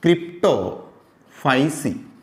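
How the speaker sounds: slow, क्रिप्टोफाइसी stretched syllable by syllable; slow teaching pronunciation; drawn-out syllables. Shown as a demonstration. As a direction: lay out क्रिप्टोफाइसी slowly for the class. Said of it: "teacher pronounces class name".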